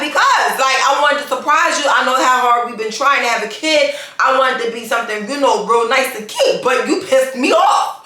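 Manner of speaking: retold rant, building to a high-pitched outburst; going off. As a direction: re-enact telling off your husband, increasingly worked up. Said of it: "I was just going off him".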